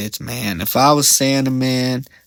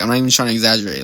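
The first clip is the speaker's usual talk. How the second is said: normal talking voice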